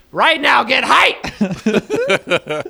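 high, very loud mock command; over-the-top shouted hype; hype yell. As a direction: yell at them to get pumped. Yelling mock order to get excited.

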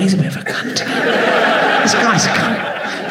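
mock heckler's whisper. Audience member whispering behind the comic's back, mock whisper. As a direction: whisper it like audience members muttering to each other. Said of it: "Just whisper stuff like".

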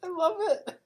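high squeak out of laughter; real giggly, high-pitched gasp; helpless laughter. Real, not acted. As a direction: barely get it out after laughing hard. Helpless after a long laughing fit.

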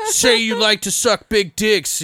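high, slow taunting jock; bullying jock. Retold or imitated. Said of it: "He's like".

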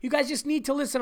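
high, weary imitation of the wife's complaint; imitating wife. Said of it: "She's like".

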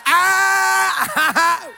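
high, loud imitated crowd scream; mock scream. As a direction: shriek like an excited audience member. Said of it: "Some of you are like".

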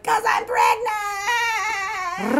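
high, drawn-out mock wail. Retelling Alicia confessing her pregnancy, hammed-up high wail. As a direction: whimper the confession like a melodramatic heroine. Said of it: "she's like".

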